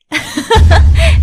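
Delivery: a loud high laugh; burst of laughter. Acted. Girl laughs at her friend's teasing, loud laugh.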